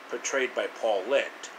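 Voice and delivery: plain reading voice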